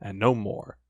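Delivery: plain reading voice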